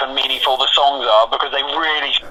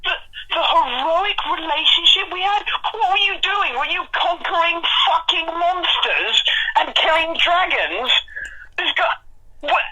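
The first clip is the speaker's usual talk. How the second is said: high outraged disbelief; incredulous; 13 semitones higher than usual. Real, not acted. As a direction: rant in squeaky disbelief. Outraged at ex's message.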